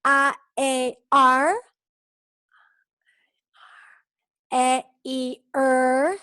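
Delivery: isolated sounds, held out for learners to copy; repeat-after-me vowel drill. Shown as a demonstration. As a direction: chant each vowel sound separately with long gaps. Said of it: "quiero que repitéis conmigo".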